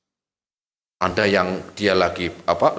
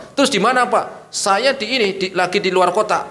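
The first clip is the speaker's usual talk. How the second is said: interrogation exchange; 9 semitones higher than usual. Acted. Questioning the lying official.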